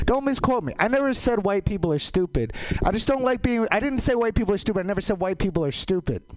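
high, pushy protest rising in pitch; worked-up denial. Acted. Comic denying a misquote to the crowd, high and agitated.

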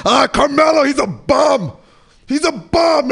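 high, mock yell: he's a bum; friend heckling. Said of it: "my friend Roger's like".

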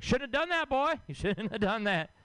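high ominous warning voice; scared uncle. Retold or imitated. Uncle warning his nephew about the vaccine, high ominous voice. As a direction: warn him like it is doom. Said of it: "he's like".

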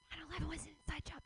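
fast hushed whisper; conspiratorial whisper. Acted. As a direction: whisper it like a secret. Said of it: "even whispering it is stupid".

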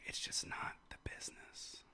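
unvoiced whispered aside. Real, not acted. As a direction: whisper it like a secret.

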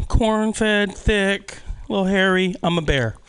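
ordinary delivery

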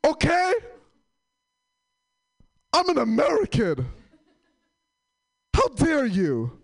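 high hammed-up solemn vow; mock patriot. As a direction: overplay the proud patriot. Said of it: "I was like".

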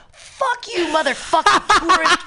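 high, loud mock screaming, laughing through it; retold yell. Retelling yelling at a stranger, loud and high. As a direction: yell it at the tourist, half laughing. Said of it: "And I just yelled".

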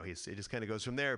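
ordinary talk

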